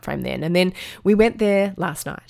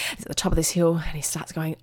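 ordinary talk, nothing marked

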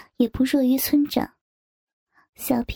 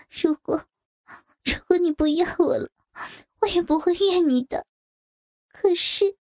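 confessing through tears; 2 semitones higher than usual. A narrator's reading. Wife confessing to her husband, breaking into sobs. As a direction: plead your love as you break down. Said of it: "声音被哭泣的呜咽全塞住了".

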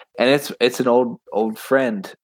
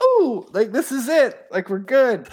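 Moog thrilled; 12 semitones higher than usual. Retold or imitated. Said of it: "He's like".

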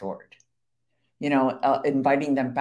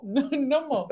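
laughing echo; 11 semitones higher than usual. Retold or imitated. Echoing husband's quote laughing.

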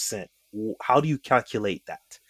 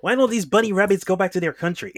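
mock indignant rant; 9 semitones higher, faster than usual. Joining in, voices mock bigot ranting.

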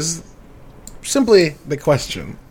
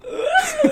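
mock sob-laugh. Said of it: "Oh, I was like".

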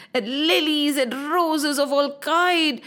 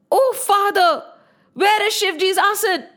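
higher-pitched, dismayed appeal; a dismayed daughter's question, pitched up; upset demand; 7 semitones higher than usual. A narrator's reading. Sati asks her father about Shivji's missing seat, upset.

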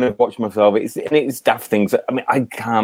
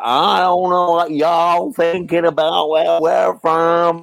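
slow, higher Louisiana drawl; Louisiana accent; 4 semitones higher, much slower than usual. Retold or imitated. Lady from Louisiana chatting, drawn-out Southern accent. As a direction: stretch every vowel in a Louisiana twang. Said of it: "a lady from Louisiana".

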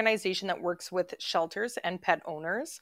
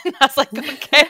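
laughing; 9 semitones higher, 14 dB louder than usual. Retold or imitated. Laughing at son's sprint.

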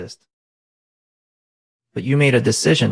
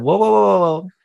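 sudden higher, louder whoas, catching himself; alarmed whoa; 7 semitones higher, 3 dB louder than usual. Retold or imitated. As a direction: stop yourself short in alarm. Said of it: "I said".

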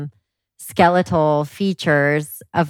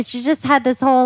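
ordinary talk, nothing marked

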